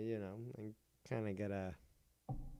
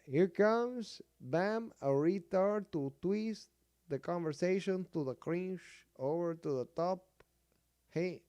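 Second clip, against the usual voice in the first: mock chatter reading; 5 semitones higher than usual. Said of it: "He says".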